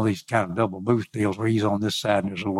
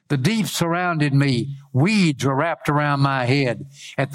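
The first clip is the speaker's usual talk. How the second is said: lifted, declaimed reading of a prayer; scripture reading; 4 semitones higher than usual. Acted. Preacher reads Jonah's prayer from the fish, declaimed. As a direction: read the prayer out in long proclaimed phrases.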